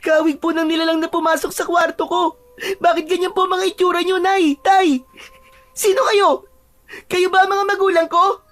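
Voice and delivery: put-on frightened child. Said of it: "dahil sa labis na takot".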